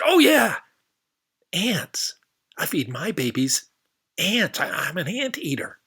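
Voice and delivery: high, loud sudden recall; delighted anteater. Acted. Anteater remembering the answer, high and excited. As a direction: burst out with the answer.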